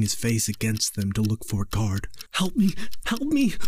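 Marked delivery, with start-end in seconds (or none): 2.3-3.5 high, panicked scream for help; a trapped boy screaming into an empty hallway; terrified scream. A narrator's reading. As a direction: shriek for a guard in terror. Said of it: "James screamed".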